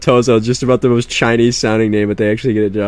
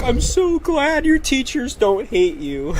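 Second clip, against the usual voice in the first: higher, slow, teary mock mom voice; crying mom; 12 semitones higher, 5 dB quieter, slower than usual. Mom after parent-teacher conferences, teary and high-pitched. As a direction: imitate mom choking up with relief. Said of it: "Because she was like".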